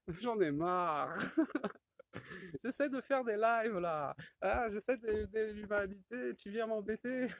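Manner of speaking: high, whiny fed-up voice, half laughing; a hammed-up moan at the little pest; mock whiny complaint. Man complaining to his pestering monkey, high mock whine.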